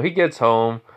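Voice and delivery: ordinary delivery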